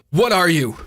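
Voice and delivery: high, forceful demand. Acted. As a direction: demand an answer, desperate. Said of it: "he demanded".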